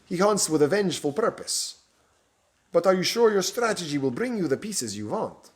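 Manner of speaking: a foreign Romanian edge, probing; Romanian accent. Acted. Stranger questioning the bishop's plan, in a Romanian accent. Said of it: "spoke again in his foreign accent".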